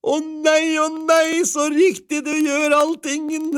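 loud, very high-pitched exclamation; delighted cry. A narrator's reading. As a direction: cry out in overjoyed admiration as the wife. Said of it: "ropte kona".

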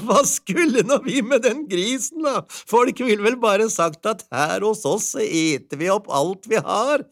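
wife waving the pig away; laughing wife. A narrator's reading. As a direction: chuckle through it.

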